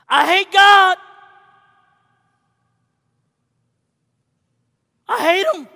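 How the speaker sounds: high, loud cry of rage; anguished shout. Retold or imitated. Son screaming to his father, loud and high. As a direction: shout it out in pain. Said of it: "But what came out?".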